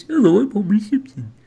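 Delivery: high, loud goofy cartoon voice; Homer Simpson. Host showing how Homer really sounds, high cartoon voice. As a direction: pitch it up into a silly cartoon character. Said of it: "but he sounds like this".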